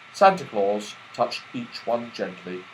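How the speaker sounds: plain reading voice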